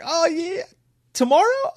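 very high mock surprise rising; surprised creepy cop. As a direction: act thrilled and pushy. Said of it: "He was like".

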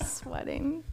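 a light New York accent on Sweating, imitating him. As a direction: say Sweating the New York way, as her boyfriend does. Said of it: "He talks like a New Yorker".

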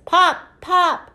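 high, short pops; high cork imitation. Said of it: "sound like a cork".

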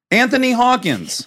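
chirpy ticket agent line. Retold or imitated. Agent misreads name, quoted.

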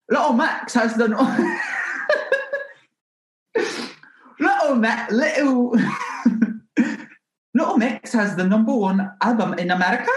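high, hammed-up British accent, breaking into laughter. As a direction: garble a thick British accent, pitched high. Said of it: "like, completely incomprehensible".

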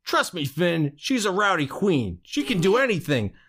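high put-on cartoon voice; character voice. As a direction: quote it in the character's voice. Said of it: "my favorite line was".

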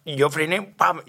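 ordinary talk

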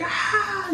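held-back falsetto scream; imitated crowd scream. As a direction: imitate an excited fan's shriek. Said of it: "All those screaming moments".

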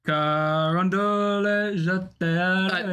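slow mock French accent, drawn out; reading a viewer's French correction in mock French accent. Playful slow French accent. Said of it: "I just was having fun".

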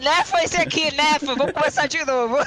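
loud, high, laughing alarm; playful frantic shouting; mock-panic calling out. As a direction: yell to abort and restart, as a joke. Mock panic, restart the game.

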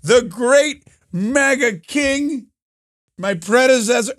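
put-on high, slow old man speechifying; hammed-up, laboured old-timer oratory; Joe Biden. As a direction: denounce them like a doddering president. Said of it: "he's like".

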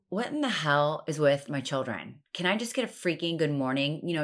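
normal talking voice

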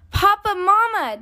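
high-pitched, breathless excited cubs; excited child bursting in with news; gasping excitement. A narrator's reading. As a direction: burst out calling, all worked up. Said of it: "so out of breath they could hardly talk".

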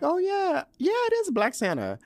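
high squeaky take on the family's easy reply; mock high-pitched relatives. The family casually agreeing about the pajamas, in a mock high voice. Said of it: "And they're like".

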